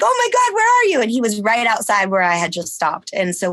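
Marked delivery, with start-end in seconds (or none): 0.0-1.0 much higher and louder delighted cry; overjoyed shock at the text; excited surprise. Retold or imitated. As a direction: squeal it out, thrilled to find him. Surprised to find Josh outside.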